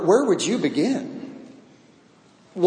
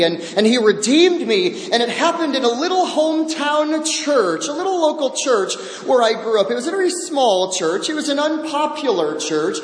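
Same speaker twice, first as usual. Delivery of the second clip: higher, bright with joy as she recalls being saved; joyful testimony; 4 semitones higher than usual. Retold or imitated. Woman telling elders of her salvation, joyful. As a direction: relive the joy of being found. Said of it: "she then lit up with joy".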